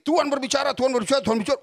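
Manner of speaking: loud, high teasing imitation of the wife; mock wife. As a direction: parrot her nagging, a little hammed-up. Mocking wife's constant claim. (meniru istri dengan nada mengejek, keras dan tinggi)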